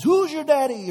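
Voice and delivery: loud, high taunting yell; mock victory shout; triumphant yell. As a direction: crow it at the enemy army. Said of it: "yells at the Philistines".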